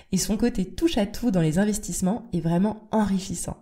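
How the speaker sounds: a bright, steady announcer read for the episode opening; podcast intro read. Acted. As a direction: present the episode like a host on air. Host reads the episode intro. (lecture d'introduction de podcast, ton d'animatrice)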